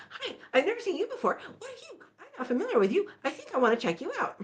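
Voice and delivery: put-on high, fast, childlike bear voice; a bright little cub, eager to check out the hiker; curious baby bear. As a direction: chirp it out like a nosy cub. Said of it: "baby bear is like".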